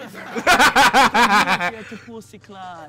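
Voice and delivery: loud drawn-out patois. Pretend. Drawn-out roadman exclamation.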